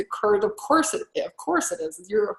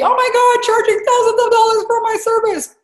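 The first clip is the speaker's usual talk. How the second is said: hammed-up panicked shout, much higher and louder; mock panic; 7 dB louder than usual. Coach imitating a terrified client, mock panicked shout. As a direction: play a client freaking out over Facebook tech. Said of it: "it might be".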